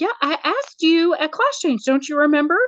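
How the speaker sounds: high-pitched, feigned innocence; mock innocent reminder. Media specialist to forgetful principal, playing innocent. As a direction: pitch it way up, all innocent insistence.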